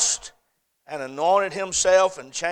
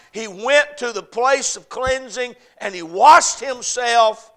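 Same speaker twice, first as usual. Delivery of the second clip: louder, higher and slower, pressing each phrase; full-force preaching; 6 semitones higher, 6 dB louder, slower than usual. Acted. Preacher presses the lesson of David's cleansing, fervent and loud. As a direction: lean into it like the sermon's peak.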